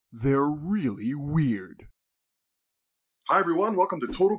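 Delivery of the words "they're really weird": slow spooky drawl. Acted. Slow spooky disclaimer tag.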